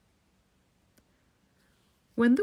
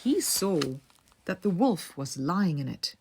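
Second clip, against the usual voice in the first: ordinary delivery, nothing marked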